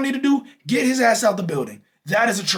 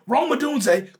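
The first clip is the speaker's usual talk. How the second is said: ordinary talk, nothing marked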